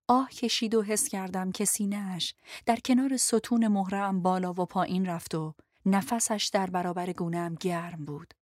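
ordinary delivery, nothing marked

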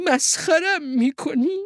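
high, drawn-out indignant question; disbelieving mother sheep. Acted. Mother sheep suspects she is mocked, high disbelieving voice. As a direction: ask it slowly in a high sheep voice.

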